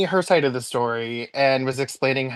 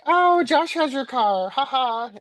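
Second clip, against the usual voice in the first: high, slow teasing singsong; mock chuckle in a high, drawn-out voice; imitated amused colleague; 11 semitones higher than usual. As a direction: act out someone breezily breaking the news, pitched up. Voices colleague who finds it funny.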